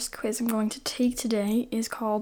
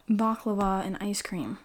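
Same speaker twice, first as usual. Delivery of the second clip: ordinary talk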